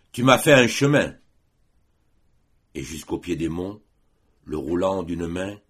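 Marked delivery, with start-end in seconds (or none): 0.1-1.1 loud, raised heroic cry; shouted exclamation. A narrator's reading. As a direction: shout it boldly to the foe below. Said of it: "cria Roland". (exclamation criée, forte et héroïque)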